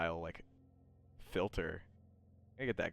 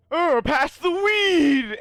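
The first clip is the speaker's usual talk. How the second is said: very loud, high, drawn-out party yell; calling out; 19 dB louder, much slower than usual. Acted. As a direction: holler it across a rowdy room. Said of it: "like, calling out".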